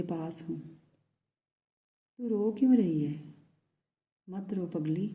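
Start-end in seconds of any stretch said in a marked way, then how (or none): 2.2-3.1 higher, louder pleading on क्यों; anguished why are you crying; pleading question. Acted. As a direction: urge her to say why she cries. Asks crying lover why.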